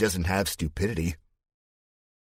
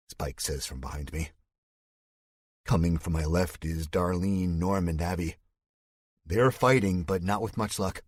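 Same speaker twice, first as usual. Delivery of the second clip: ordinary delivery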